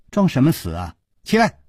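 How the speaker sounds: high, loud princess scolding him to get up; impatient scolding. A narrator's reading. Princess scolds man under blanket.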